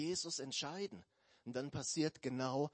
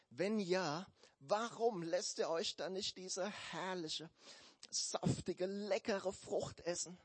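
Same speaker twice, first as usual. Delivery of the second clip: higher, coaxing snake voice; tempting serpent voice; 5 semitones higher than usual. Acted. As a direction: lure her like a sly snake. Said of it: "fragte die Schlange".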